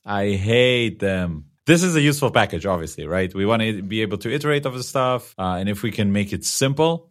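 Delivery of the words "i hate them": "I hate them" stretched very slowly; drawn-out emphasis. Real, not acted. Host repeating a verdict on the functions to his co-host, "I hate them" slow and emphatic. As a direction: draw out every word of "I hate them".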